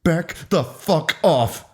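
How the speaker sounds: slow, tight threat; contained slow fury; through gritted teeth. A narrator's reading. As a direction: say it word by word with teeth clenched. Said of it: "Haley spoke through gritted teeth".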